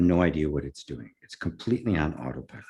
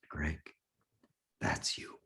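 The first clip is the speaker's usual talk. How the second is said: real emotion, voice failing to a whisper; choked up; 10 dB quieter, slower than usual. Real, not acted. The teller reaches his story's climax, choked up. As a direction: barely get the words out, very soft and halting.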